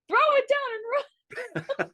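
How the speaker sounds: high, slow urgent advice; urgent mock warning. Telling the friend surrounded by dogs to drop the sandwich and run, high and urgent. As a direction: tell him to drop it and flee, half laughing.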